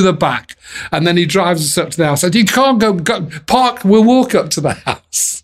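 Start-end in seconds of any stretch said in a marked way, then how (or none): 2.3-5.4 lightly voiced old manager, higher; a hint of the Colonel, pitched up and insistent; imitating the Colonel. The Colonel directing them on arrival.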